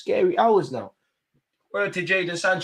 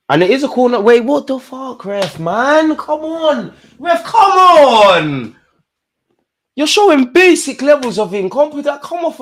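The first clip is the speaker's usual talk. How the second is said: much higher and louder, slowed disbelieving yell; outraged shout; 9 semitones higher, 6 dB louder than usual. Real, not acted. Fan protesting the referee's corner decision, outraged shout. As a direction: drag out the protest at the ref.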